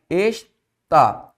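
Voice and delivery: "está" in two separated syllables; split into syllables. Shown as a demonstration. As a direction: break "está" as es, pause, tá.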